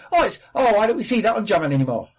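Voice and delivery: high, loud, fast mocking voice of a bigot. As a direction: ham up a clueless bigot lamenting. Mocking what racist people say.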